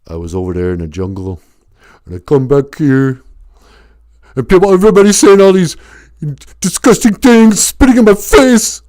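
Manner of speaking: Stallone's wounded veteran building to a cry; Sylvester Stallone impression. Veteran tells the sheriff his grievance, Stallone voice building. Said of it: "sounded exactly like Sylvester Stallone".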